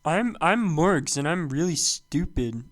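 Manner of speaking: slow, drawn-out mocking impression; exaggerated slow self-introduction, mockingly dumb; Morgs. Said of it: "I gotta work on this impression".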